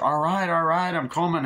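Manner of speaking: high, loud call toward the door. Retold or imitated. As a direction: answer an insistent knock from across the house. Acted calling to a knocked door.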